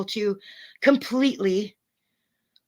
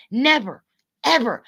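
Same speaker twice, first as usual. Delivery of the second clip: NEVER and EVER hit hard and louder; emphatic, louder repeat of the denial; 4 dB louder than usual. Real, not acted.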